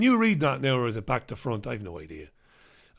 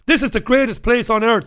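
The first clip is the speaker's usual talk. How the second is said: impression; 5 semitones higher, 6 dB louder than usual. Quoting Boris Johnson.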